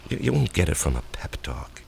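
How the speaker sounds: soft, unvoiced and low, giving in; whispered admission. Husband to wife, admitting he lacks confidence, whispered. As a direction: breathe it out like a confession.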